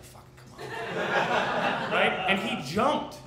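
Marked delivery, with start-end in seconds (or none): 0.0-1.9 slow, breathy challenge to the dog; slow taunt. Retold or imitated. Jogger wheeling round to dare the tiny dog, slow taunt. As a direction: drag it out, daring him to come. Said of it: "I was like".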